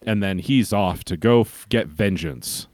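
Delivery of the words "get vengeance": ordinary delivery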